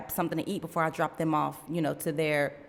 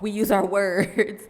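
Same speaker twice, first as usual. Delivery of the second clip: laughing; 4 dB louder than usual. Real, not acted. Laughing at her own scolding.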